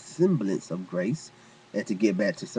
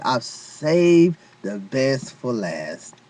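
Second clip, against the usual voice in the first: slow declaration; much slower than usual. Acted.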